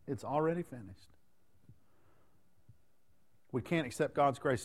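ordinary talk

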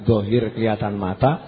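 ordinary talk, nothing marked

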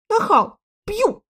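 high, sputtering outrage; outraged squeaky exclamations; choking indignation. A narrator's reading. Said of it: "захлебнулась воздухом от негодования".

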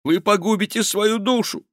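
very high-pitched alarm; shocked, pious king; horrified warning. A narrator's reading. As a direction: warn him in scandalised falsetto. King warns blaspheming monk.

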